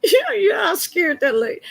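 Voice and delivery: laughing it out, voice cracking high. Real, not acted. Laughing while telling how she scared the dentist. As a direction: giggle through the words.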